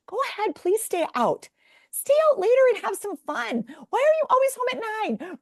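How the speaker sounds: high, bright pushy mom urging her shy daughter; mock cheerful urging. Mother coaxing her daughter to go out, high and eager. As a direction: squeal it out, way up high.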